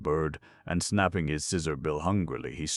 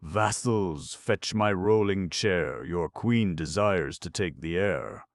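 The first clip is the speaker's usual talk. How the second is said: high, loud and haughty, slow at first; a patchwork girl playing queen, lordly; 5 semitones higher, 7 dB louder than usual. A narrator's reading. To her vassals, haughty loud command. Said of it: "cried haughtily".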